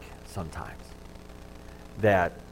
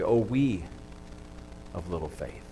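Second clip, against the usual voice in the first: quiet; 4 semitones lower, 5 dB quieter than usual. Acted. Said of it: "said to Jesus".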